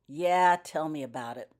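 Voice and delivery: a sharp, loud snap on yeah; snapped. A narrator's reading. Wizard cutting Daniel off, snapping loudly. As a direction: bite the words off in irritation. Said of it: "Wizard Flint snapped".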